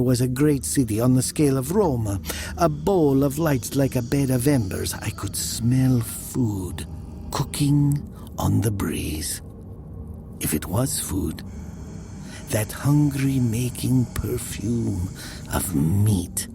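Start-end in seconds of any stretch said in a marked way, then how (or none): none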